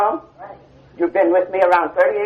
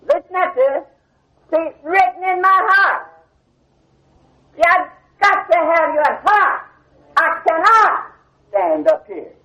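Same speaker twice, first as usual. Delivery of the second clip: fervent preaching; 9 semitones higher, slower than usual. Acted. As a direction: preach it from the heart, climbing. Preacher rising in fervour.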